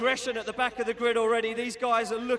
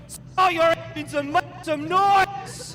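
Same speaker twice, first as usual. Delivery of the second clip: slow, stretched starting call; hype shout; 4 semitones higher, 4 dB louder, slower than usual. Real, not acted. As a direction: belt the race start.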